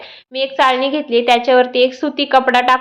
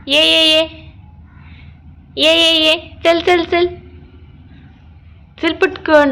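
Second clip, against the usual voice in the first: higher, coaxing call; calling a pet; 4 semitones higher, slower than usual. Real, not acted. Mother calls the cat over with a coaxing voice. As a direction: coax the cat to come.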